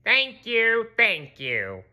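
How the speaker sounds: a hint of a smug Squidward; bow graciously; Squidward thanks. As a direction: Squidward bowing to crowd, lightly voiced. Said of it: "said Squidward, bowing".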